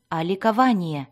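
ordinary delivery, nothing marked

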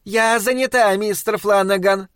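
high shout from another room; wife shouts back from the storeroom. Acted. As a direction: call back, busy. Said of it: "громко крикнула его жена".

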